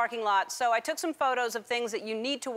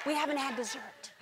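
hushed, soft mock-condescending aside; 3 dB quieter than usual. Family telling waiting driver they're not leaving, condescending. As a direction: brush them off in a very soft, patronizing voice. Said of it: "they give that condescending".

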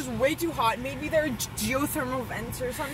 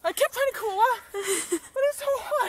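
whining about heat; 8 semitones higher than usual. Real, not acted. Hot water, whining.

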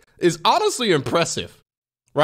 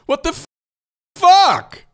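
disbelieving shout; 13 semitones higher, 7 dB louder, slower than usual. Real, not acted. Streamer shocked by chatbot reply.

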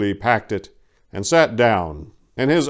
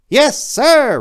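much higher, louder cheerful reply; happy elf; 8 dB louder than usual. Acted. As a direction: chirp it back gladly. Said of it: "responded Jacob happily".

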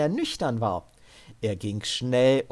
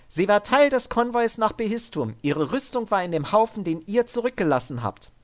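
higher, firm but controlled order; armored soldier woman; 9 semitones higher, 3 dB louder, faster than usual. Acted. Sid ordering Devin up at gunpoint, higher and firm. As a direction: keep him steady with a calm threat. Sid's voice.

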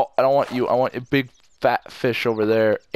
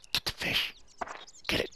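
tense hushed whisper; 12 dB quieter, faster than usual. Real, not acted. Fisherman urging the catch, tense whisper. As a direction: urge it on under your breath.

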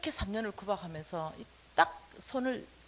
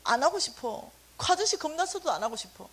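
ordinary talk, nothing marked

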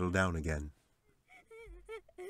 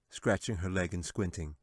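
usual speaking voice

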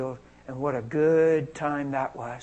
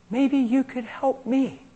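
lady asking for help; 9 semitones higher, slower than usual. Retold or imitated. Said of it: "Lady in a chair".

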